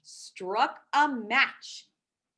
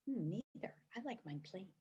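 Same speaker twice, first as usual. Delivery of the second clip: much softer aside; quiet aside; 3 semitones lower, 14 dB quieter than usual. Real, not acted. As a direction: drop to a hush.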